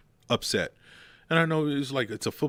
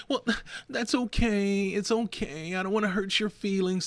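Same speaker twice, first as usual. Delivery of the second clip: mock coddling voice; 9 semitones higher than usual. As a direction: gently mimic a hand-holder. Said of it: "I'm tired of saying".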